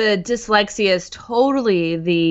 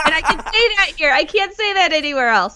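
laughing; 8 semitones higher, faster than usual. Real, not acted. Laughing after a cheeky joke.

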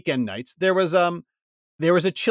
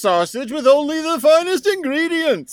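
booming arrogant alien overlord; 9 semitones higher than usual. Acted. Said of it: "can Pizza Express Man say hello".

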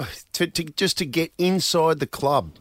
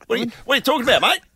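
put-on broad Australian accent, a bit louder and higher; ocker Aussie bloke; 10 semitones higher, 6 dB louder, much faster than usual. Mate showing off a broad Australian accent to the locals. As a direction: play up the crocodile hunter Aussie. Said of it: "You should introduce yourself".

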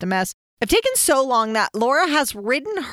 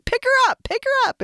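retold yelling. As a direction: yell it over and over from a car window. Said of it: "everyone was yelling".

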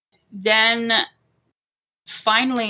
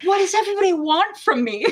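high-pitched plaintive outcry, retold; high mock wail; mock distressed plea; 10 semitones higher than usual. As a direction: imitate an overwhelmed woman's plea, laughter close by. Acting out mother's plea in labor.

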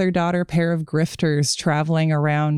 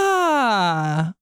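drawn-out falling "ah" of dismay; long, sinking cry at bad news; dismayed wail. Real, not acted. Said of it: "I am having a response to this".

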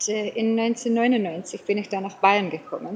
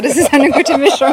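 laughing through the words; 4 semitones higher, 13 dB louder than usual. Real, not acted. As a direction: say it while breaking into laughter. Joking about shared dialects.

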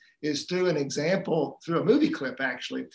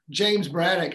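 ordinary talk, nothing marked

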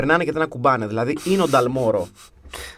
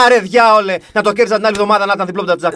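loud, higher-pitched griping that eases off; mock grumbling; 5 semitones higher, 6 dB louder, faster than usual. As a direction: complain like an exasperated fan, opening with an outburst. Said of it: "θέλω να γκρινιάζω".

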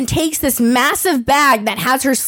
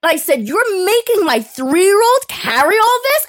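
indignant confrontation; 7 semitones higher than usual. Retold or imitated. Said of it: "I went".

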